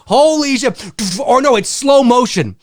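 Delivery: normal talking voice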